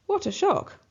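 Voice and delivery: high, startled exclamation; what a shock, squeaked high. A narrator's reading. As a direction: gasp it out in surprise.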